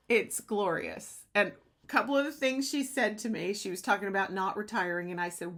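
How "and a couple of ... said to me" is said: ordinary delivery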